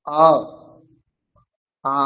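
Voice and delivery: আ drawn out, held over a second; long Sanskrit আ; long vowel demo. Shown as a demonstration. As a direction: stretch the আ long. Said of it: "এক সেকেন্ডের থেকে একটু বেশি হলে সেটা হবে দীর্ঘ".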